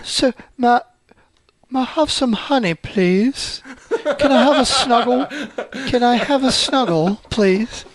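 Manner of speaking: halting, gentle attempt at a storybook bear; Winnie the Pooh. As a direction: plead sweetly for honey in a put-on bear voice. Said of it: "I gotta get the right voice in my head".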